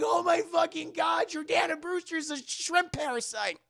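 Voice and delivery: high, loud mock-horrified exclamation; shocked outcry. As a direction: cry out in disbelief at the reveal. Everyone reacts to the monster reveal.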